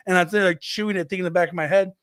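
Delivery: ordinary talk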